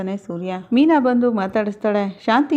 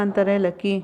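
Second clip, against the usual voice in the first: plain reading voice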